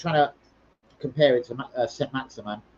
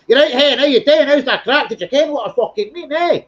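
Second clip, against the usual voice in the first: hammed-up Scottish geezer, much higher and louder; mock Scottish accent; 9 semitones higher, 7 dB louder than usual. Geezer in the BT advert calling his mum in Scotland, loud mock Scottish. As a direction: shout it down the phone like a Scotsman from an old advert. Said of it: "to call his mum in, like, fucking Scotland".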